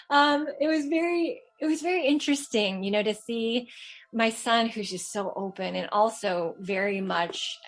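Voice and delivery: usual speaking voice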